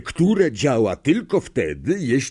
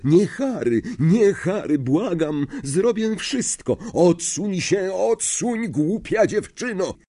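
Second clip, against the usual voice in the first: much higher, pleading cries; mother's desperate plea; 9 semitones higher than usual. Acted. Harry hears his mother begging Voldemort, desperate. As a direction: beg for your child's life.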